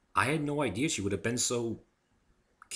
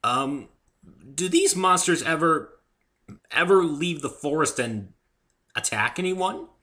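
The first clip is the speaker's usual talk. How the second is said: higher, louder nervous question; nervous man faking calm; failing to sound casual; 8 semitones higher, 8 dB louder than usual. A narrator's reading. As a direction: try to stay casual and fail. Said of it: "trying to sound casual".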